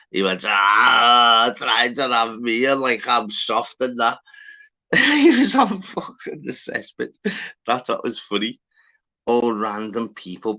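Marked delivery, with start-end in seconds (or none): 0.5-4.2 loud, drawn-out mock indignation; imitated scoffing protest. Acting out the man refusing belief. 4.9-7.1 high burst of laughter into the words; laughing. Real, not acted. Cracking up at his own story.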